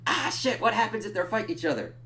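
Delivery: high, quick alarmed yelp. Retold or imitated. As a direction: blurt it out in sudden alarm. Imagined alarm at fight.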